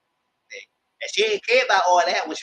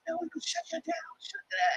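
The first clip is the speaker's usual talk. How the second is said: shrill mock threat; 10 dB quieter than usual. Lauren threatening shutdown.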